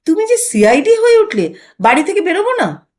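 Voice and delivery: embarrassed and cornered, pushing back; flustered. Acted. Husband protesting wife's spying, flustered and exasperated. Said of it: "বিব্রত হয়ে বলেন".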